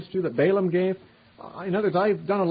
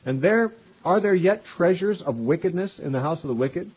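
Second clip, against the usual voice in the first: higher, louder proclamation; scripture reading; 5 semitones higher, 4 dB louder than usual. Acted. Preacher reading the Lord's cry to the city, higher and louder.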